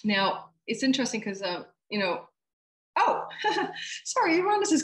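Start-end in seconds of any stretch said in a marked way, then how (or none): 3.0-4.8 sudden high laughing oops; surprised laugh. Real, not acted. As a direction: catch the slip with a laugh. Catches her own mistake.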